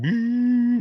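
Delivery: high, drawn-out mimicked hum; high vocal whoosh of a machine going off; imitated machine noise. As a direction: imitate the machine with one long high note. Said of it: "we hear it going".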